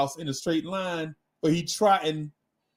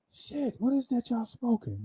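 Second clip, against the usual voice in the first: stoned dog voice; 5 semitones higher, 7 dB quieter than usual. Retold or imitated. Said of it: "this is how the dog talking".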